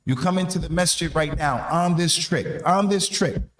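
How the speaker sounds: ordinary talk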